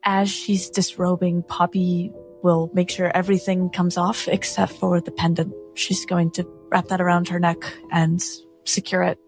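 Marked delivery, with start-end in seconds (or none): none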